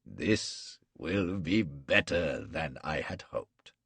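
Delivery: menacing captor. Acted. Gul gloating over his prisoner, slow and menacing. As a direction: savor every cruel word.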